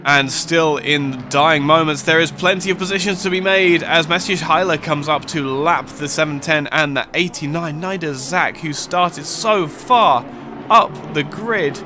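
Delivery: fast, lively play-by-play call; live race commentary. Acted. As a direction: call the final laps like live sport.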